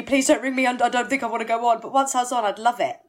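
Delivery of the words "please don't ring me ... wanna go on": higher and louder pleading, rising at the end; escalating mock panic; more desperate plea. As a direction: push the plea up a notch, louder. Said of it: "this says a lot about me".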